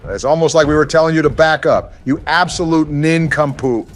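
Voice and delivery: high, loud mocking scold; being chased. Real, not acted. Scolding friend during chase.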